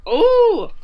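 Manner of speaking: high, loud "oh"; retold exclamation. Host reacting aloud to the bird's pep talk, exclaiming.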